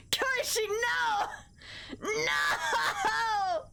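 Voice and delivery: high, melodramatic cries of betrayal; mock anguished wail. Streamer cries out to Koishi over a betrayal, mock anguished wail. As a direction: wail it in hammed-up despair, drawing out each no.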